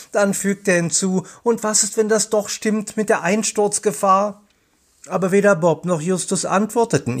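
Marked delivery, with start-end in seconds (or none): 1.4-4.4 higher, worried question. A narrator's reading. Peter asking his friends about the cave-in danger, worried. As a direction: raise the voice in mild worry.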